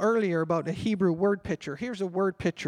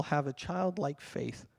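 softer and slower, savouring each word; hushed, reverent quote, slowed down; soft, slow scripture quote; 5 semitones lower, 7 dB quieter, slower than usual. Shown as a demonstration. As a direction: read it gently like scripture. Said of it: "it says in the Bible".

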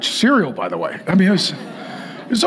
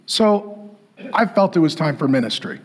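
ordinary talk, nothing marked

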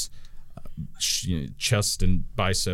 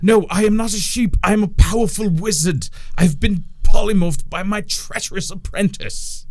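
much higher, loud and offended; indignant sheep wizard; 11 semitones higher, 6 dB louder than usual. Acted. Sheep wizard corrects the orc player, high and huffy. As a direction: bristle at being called a sheep.

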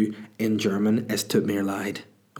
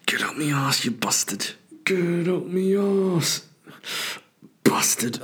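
breathy strain rising to high, drawn-out wail; straining English guy; 8 semitones higher than usual. Retold or imitated. Comic replays toilet stranger's rant, strained high cursing. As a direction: mimic agonised pushing between curses. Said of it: "the voice note of him going".

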